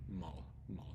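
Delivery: "Mall" repeated with a soft L at the end. Shown as a demonstration. Teacher repeating the example for students, soft final L. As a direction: let the closing L of "mall" fade gently. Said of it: "a softer L at the end".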